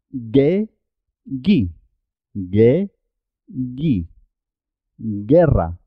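hard G straight into E or I in "ge, gui, guerra"; "ge, gui, guerra" as gheh, ghee, GHEH-rra; silent U after G. Shown as a demonstration. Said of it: "La U es muda en este caso".

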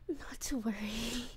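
creepy hushed whisper. Acted. Captor whispers to waking captive, creepy hush. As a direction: breathe it close to his ear.

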